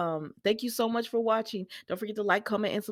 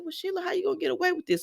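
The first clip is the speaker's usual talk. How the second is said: high, fast skeptical question; quick sassy challenge; 10 semitones higher, faster than usual. Retold or imitated. Host quizzing Sheila on her escape, high and fast. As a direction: rattle off a doubtful challenge. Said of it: "I said".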